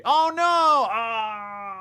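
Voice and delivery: high, loud drawn-out wail; long theatrical howl of dismay; mock anguish. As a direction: cry out in hammed-up despair. Told it is worst Marvel movie.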